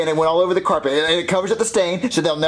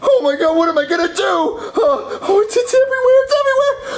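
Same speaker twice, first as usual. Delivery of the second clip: high, crying panic, voice cracking upward; panicked wail; 12 semitones higher than usual. Acted. Kid panicking at the cop over the ruined carpet, crying wail. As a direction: wail like the mess is a disaster.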